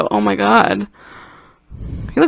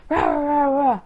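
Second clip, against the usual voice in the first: higher chanted rah rah; mock rage noises; 7 semitones higher than usual. Ray freaking out about the kiss, higher mock tantrum. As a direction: grumble it like a cartoon tantrum. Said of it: "and he was like".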